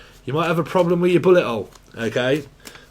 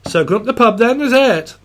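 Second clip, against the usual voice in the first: higher, louder Welsh lilt; mock Welsh accent; 5 semitones higher, 4 dB louder than usual. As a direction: invite a mate to the pub in a Welsh voice. Channeling Welsh pub invite.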